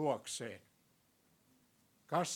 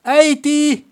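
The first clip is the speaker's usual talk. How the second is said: put-on much higher, loud child's cry of Äiti; child calling out; 13 semitones higher, 12 dB louder, slower than usual. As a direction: call out like a kid in the back seat. Child calling parents in traffic.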